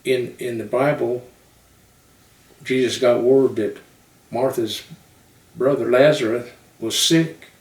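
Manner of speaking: put-on Southern-accented sermon storytelling with punched phrases; Southern preacher. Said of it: "but they talk like this".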